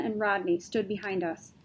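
usual speaking voice

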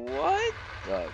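high startled squeak. Real, not acted. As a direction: squeak in shock.

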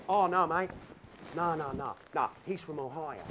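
put-on poking fun with an Aussie accent; Australian accent. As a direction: explain it in mock-Aussie. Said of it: "poking fun at my beautiful Aussie friend".

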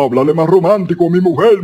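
comic husky voice, quick run of words; hoarse man wishing to sound romantic. Acted. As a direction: say it in a rough put-on voice. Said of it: "esta voz no es nada romántica".

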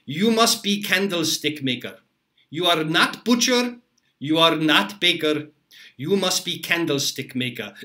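imitating a mentor's heavy Polish accent, lightly done; mock Polish chef scolding with a chuckle; half-serious Polish-accented put-down. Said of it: "with this heavy Polish accent and he would say".

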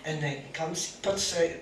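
ordinary delivery

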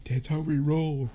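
slow, drawn-out catchphrase; weird put-on delivery. Real, not acted. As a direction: stretch it out oddly. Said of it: "Okay, that was weird".

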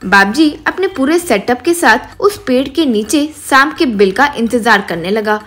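ordinary delivery, nothing marked

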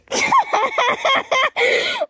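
long high-pitched laugh; high giggling fit. Real, not acted. Guest teases host about wig glue, high giggling. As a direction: crack up at your own joke.

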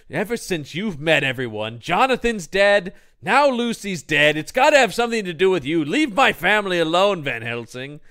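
loud, high, grief-stricken blame; hammed-up accusation, hammering out each charge; Arthur lashing out. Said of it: "turns this right back around on Van Helsing".

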